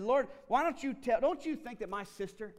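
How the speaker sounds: loud, high, indignant plea; indignant complaint. Retold or imitated. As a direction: demand that Jesus take her side, loud and high. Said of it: "She said".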